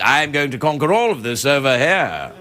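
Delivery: put-on a touch of a menacing English accent; mild mock English baddie. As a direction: say it like a lightly voiced English villain. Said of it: "if you do it in an English accent".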